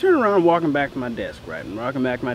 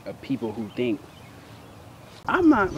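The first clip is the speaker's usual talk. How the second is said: normal talking voice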